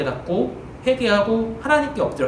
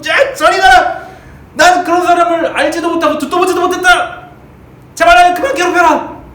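much higher and much louder, a voice unlike his own; furious shout; 10 semitones higher, 9 dB louder than usual. Acted. Peter shoving through the crowd, shouting his third denial. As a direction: yell at them to get away. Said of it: "베드로가 이렇게 다 부딪히면서".

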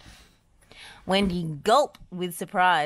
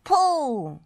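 high, loud, held order; long urgent call; shouted command; 10 semitones higher, 7 dB louder than usual. A narrator's reading. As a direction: holler the word to a team. Shouted command during the rescue.

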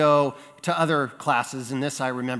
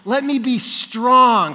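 loud, high, drawn-out pleading; dramatized child's desperate prayer; desperate retold prayer; 6 semitones higher, 5 dB louder, slower than usual. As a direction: beg God for help, acted out. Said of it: "and I prayed".

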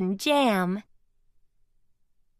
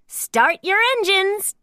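race call; 8 semitones higher than usual. A narrator's reading. Doc starting a toy race.

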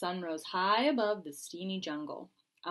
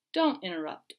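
ordinary delivery, nothing marked

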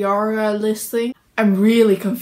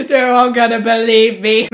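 louder, laughing gloat; 7 dB louder than usual. Pretend. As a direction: laugh at how gullible they are. Gloating about fooling fans.

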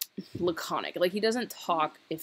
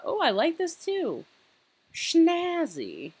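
delighted; 12 semitones higher than usual. Real, not acted. Admiring the outfit.

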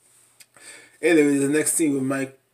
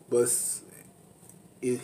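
usual speaking voice

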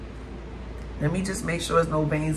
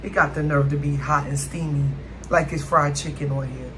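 ordinary delivery

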